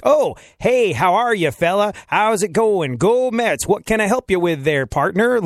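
high-pitched, overdone American chumminess; exaggerated American sales-counter friendliness; overly Americanized. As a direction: ham up an American greeting for the tourist. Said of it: "They overly Americanize when they talk to you".

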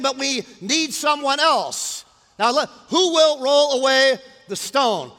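high, loud, emphatic sermon voice; full-force preaching. Real, not acted. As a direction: proclaim it to the whole room.

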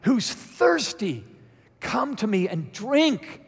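high, loud, slow proclamation. Acted. Jesus calling to thirsty people at the festival, yelling. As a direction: cry it out slowly to a whole crowd. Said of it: "Jesus said in a loud voice".

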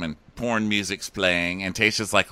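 ordinary talk, nothing marked

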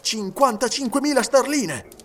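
high, emphatic announcement of the sum; emphatic repetition. A narrator's reading. Detective repeating the sum to the servant, emphatic. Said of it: "ripeté Fix".

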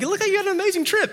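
high, quick mock-excited voice; excited high-pitched quote; gushing followers. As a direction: imitate fans gushing, fast and high-pitched. Imitating gushing Instagram followers.

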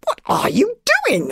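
high, incredulous question; puzzled boy's high-pitched query; curious. A narrator's reading. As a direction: ask it high-pitched, full of baffled curiosity. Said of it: "asked Fishlegs curiously".

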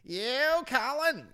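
loud, high-pitched mock yell; smecked-out spide. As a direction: holler it out like a Belfast spide. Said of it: "this fucking spide appears at the side, full smecked out".

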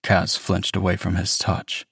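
plain reading voice

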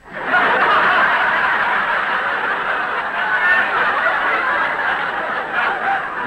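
real loud laughter; breaking up laughing. Real, not acted. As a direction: burst out laughing. Breaks up at flubbed line.

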